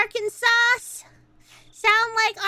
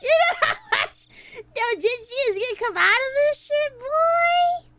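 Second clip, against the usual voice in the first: laughing yell; 7 semitones higher than usual. Real, not acted. Laughing yell repeating joke.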